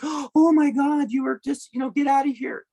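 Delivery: hammed-up horrified squeal; mock horrified. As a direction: gasp it like a scandalized bystander. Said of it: "coming from it from a human perspective and going".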